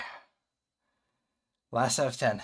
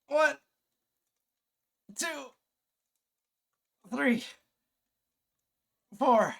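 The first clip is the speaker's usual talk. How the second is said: strained counting through push-ups; grunting out each rep; straining counting; 11 semitones higher, slower than usual. Real, not acted.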